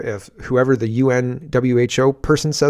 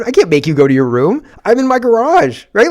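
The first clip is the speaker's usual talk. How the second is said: ordinary talk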